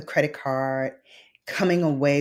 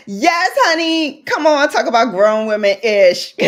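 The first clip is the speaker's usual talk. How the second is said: excited gush; 9 semitones higher, 9 dB louder than usual. Real, not acted. Gushing hype to her viewers.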